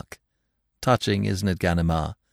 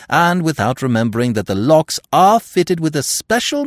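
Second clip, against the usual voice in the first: much higher, louder, mocking crow; gleeful taunting; 9 semitones higher, 5 dB louder than usual. A narrator's reading. Lupin laughing at the trapped inspector, loud gleeful taunting. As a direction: gloat over his blunder. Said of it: "Lupin burst into a fit of laughing".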